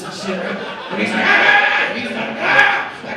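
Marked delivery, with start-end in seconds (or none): 1.2-1.9 loud strained cry; mock struggling yell. Man held from behind by passenger, mock struggling yell. 2.2-2.9 loud strained cry; mock struggling yell. Man held from behind by passenger, mock struggling yell.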